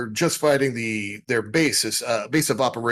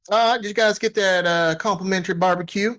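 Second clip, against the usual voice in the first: high-pitched, folksy parking attendant; folksy parking controller; 9 semitones higher than usual. Acted. Parking control to departing ship, folksy and high-pitched.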